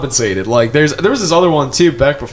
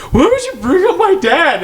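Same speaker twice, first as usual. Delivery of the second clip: mock offended squeak, much higher; indignant actress. Imitating an actress asked about her dad, high mock indignation. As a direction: voice a wounded porn actress, way up high.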